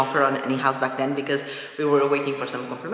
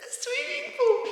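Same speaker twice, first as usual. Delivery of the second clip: excited squeal. Real, not acted. Excited about the new pool.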